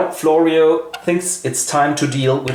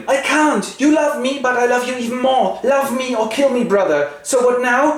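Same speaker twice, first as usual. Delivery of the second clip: much higher, hammed-up pleading; a pitched-up girlish love plea; mock lovestruck sister; 11 semitones higher, faster than usual. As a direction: swoon the lines up high.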